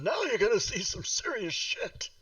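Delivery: high, excitable character impression; Christopher Lloyd. Player announcing the next move, bad movie-star impression. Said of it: "my horrible Christopher Lloyd impression".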